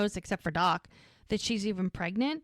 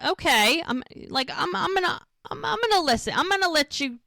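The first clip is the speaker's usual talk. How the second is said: higher-pitched, mock-reluctant concession; imitated annoyed giving-in, pitched up; grudgingly pissed; 8 semitones higher than usual. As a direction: retell her grudging okay, stumbling into it. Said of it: "She's still pissed".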